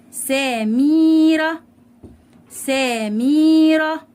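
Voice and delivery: سميره very slow, high and loud, "sa-meeee-ra"; سميره stretched syllable by syllable; drawn-out long vowel. Shown as a demonstration. Teacher sounds out example word slowly.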